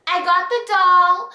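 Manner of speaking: imitating a child's lilting story; little girl storytelling. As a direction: recount it like a cute little kid. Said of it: "You know how kids tell stories".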